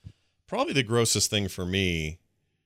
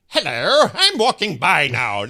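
high, loud, slow mock affected British accent; mocking a nutty put-on British accent; 9 semitones higher, 8 dB louder, slower than usual. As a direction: ham up an affected British accent like a goofy stroll-by greeting. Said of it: "obviously was affecting something a little nuttier".

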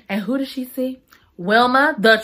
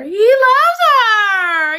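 high, slow, loud laughing cry; high, slow, laughing squeal; gushing; 13 semitones higher, 6 dB louder, much slower than usual. Real, not acted. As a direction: squeal it with joy. Gushing about Archer.

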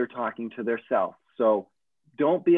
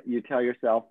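normal talking voice

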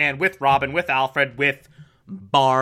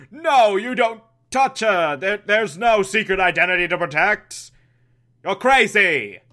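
hammed-up flustered protest; mock panic; 3 semitones higher than usual. Alfred stops Penguin exposing the secret, mock-panicked protest. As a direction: imitate a frantic cover-up.

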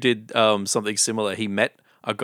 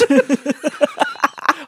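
a burst of high-pitched laughter; loud high laugh; 5 dB louder than usual. Real, not acted. Laughs at Lynette joke.

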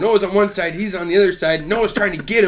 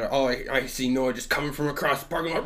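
usual speaking voice

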